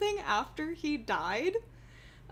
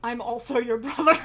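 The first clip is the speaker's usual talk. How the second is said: mock dramatic reveal; 7 dB louder than usual.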